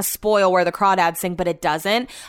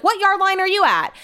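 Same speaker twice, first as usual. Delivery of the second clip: higher, eager question from the caller; chatty caller's question; 10 semitones higher than usual. Retold or imitated. Mocking the caller asking her age, eager and high. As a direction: imitate the caller, pitched up.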